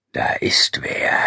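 slow, voiceless vampire rasp; animal grunt. A narrator's reading. Feeding vampire senses an intruder, animal grunt. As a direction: grunt it out slowly, almost in a whisper. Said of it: "dann grunzte er animalisch".

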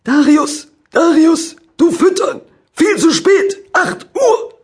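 loud, high broken German yelling; shouting farmer. Acted. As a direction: roar at the farmhand, furious. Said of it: "rief Bauer Helmut".

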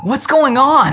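put-on confused boy calling out in alarm; startled question. A narrator's reading. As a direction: blurt it out, jolted awake. Boys jolted awake by siren.

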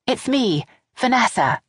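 a hint of a nervous daughter-in-law; Vanessa voice. A narrator's reading.

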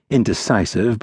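ordinary delivery, nothing marked

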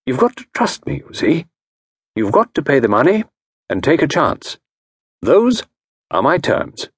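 diagnose her case with calm authority; a genteel consultant voice; Parker Pyne. Acted.

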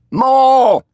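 high, loud drawn-out shout; bold calling out. A narrator's reading. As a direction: holler for an encore. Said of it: "one of the bolder spirits called out".

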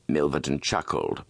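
plain reading voice

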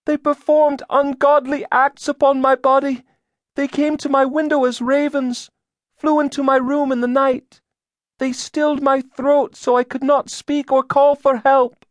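put-on very high, loud accusing girl's voice; entranced girl. A narrator's reading. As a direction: testify in a trance, high-pitched throughout. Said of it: "She spoke as if in a trance".

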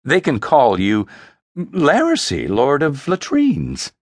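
lightly taunting sister, teasing a nickname; smirking mockery. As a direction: sneer the mock title. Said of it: "she smirked".

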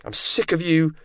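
high, fed-up outburst; heated, high-pitched snap; angry yell. A narrator's reading. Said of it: "Yvette yelled".

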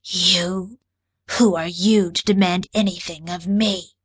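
lightly voiced cold alien, a hint of grit; cold disdain, barely raising the voice; a touch of gravel, looking down on a human; grating alien commander. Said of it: "Her voice grated like ground glass crunching under boots".

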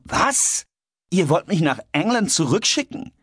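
high, appalled question; incredulous, voice shooting upwards; outraged disbelief. A narrator's reading. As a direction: cry out as if betrayed. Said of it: "als er nun wild zu schreien begann".

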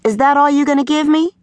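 put-on high, sassy girl's question; little diva attitude. A narrator's reading. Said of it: "decided to give Taz a little attitude".

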